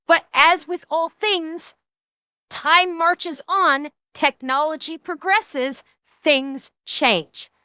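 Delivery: measured, drawn-out delivery for emphasis; slow, deliberate. Real, not acted. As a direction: spell it out slowly, phrase by phrase. Solemnly making point about progress.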